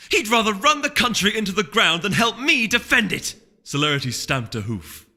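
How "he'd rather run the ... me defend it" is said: high, loud, outraged tirade; indignant rant. Acted. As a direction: let the indignation boil over. Celerity ranting about Blue Blood.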